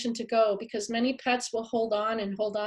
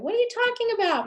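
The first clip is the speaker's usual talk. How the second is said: much higher, fast indignant disbelief; mock-indignant emailer, high and quick; incredulous protest; 11 semitones higher, faster than usual. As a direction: squeak out your outrage. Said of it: "emails from people going".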